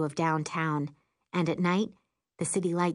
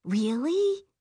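higher, eager question; excited; 7 semitones higher than usual. A narrator's reading. Mom hearing the news, asking excitedly. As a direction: ask with rising delight. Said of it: "she asks excitedly".

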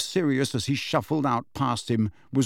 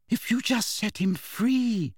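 higher, slow, hopeful suggestion; 11 semitones higher, slower than usual. A narrator's reading. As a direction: offer the idea hopefully. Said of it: "said Hermione hopefully".

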